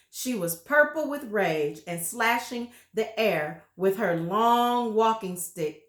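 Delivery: ordinary delivery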